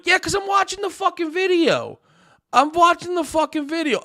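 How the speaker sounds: high, shouted whiny mimicry; mocking whiny voice. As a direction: imitate a whiny viewer, high-pitched. Mocking viewer's excuse in whiny voice.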